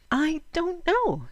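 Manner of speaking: put-on high, slow sleepy child; small girl voice. Lena answers her mother, a sleepy little girl. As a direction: sound small and drowsy.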